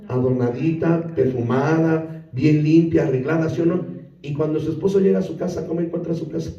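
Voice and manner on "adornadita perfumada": Adornadita, perfumada each stretched slowly and low; drawn-out lower. Shown as a demonstration. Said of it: "¿cómo tenía su casa?".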